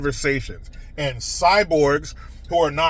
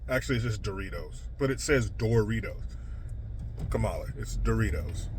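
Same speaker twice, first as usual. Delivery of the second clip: much softer, tossed-off correction; muttered aside; 10 semitones lower, 11 dB quieter, faster than usual. Real, not acted. Scornful host mutters a correction to Kamala, much softer. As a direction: drop the voice to a quick muttered aside.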